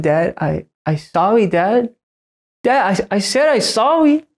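put-on high child voice, rising as she insists; a three-year-old begging her dad; toddler pleading. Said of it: "She said".

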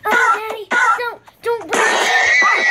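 high loud slow whiny begging; mock pleading child. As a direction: wail like a scared kid.

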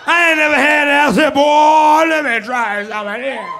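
Bernie Mac bit; Bernie Mac impression. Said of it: "show off my Bernie Mac impression".

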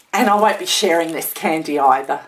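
ordinary delivery

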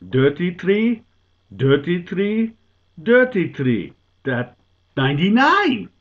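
put-on loud, high 'tə˞ti θɹi' for dirty tree, accented; hammed-up Dutch accent, building to the payoff; old Dutchman crowing in a thick Dutch accent; Dutch accent punchline.

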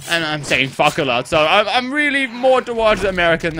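ordinary talk

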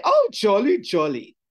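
mock the boy's fake posh English accent; fake foreign twang. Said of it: "his foreign twang the boy was a put on".

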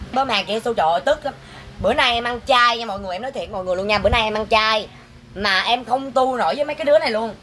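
ordinary talk

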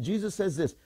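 ordinary delivery

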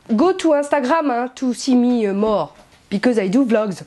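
English with a very French accent. Shown as a demonstration. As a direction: put on the most French accent possible in English. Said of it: "j'ai pris mon accent, euh, le plus français du monde". (anglais avec l'accent le plus français du monde)

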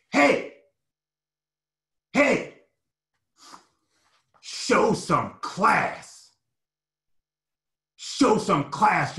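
high, loud, very slow coach's rebuke; stern coach. Retold or imitated. Coach grabbing a player by the shoulders, loud slow rebuke. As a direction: drag it out like a coach scolding. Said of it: "he goes".

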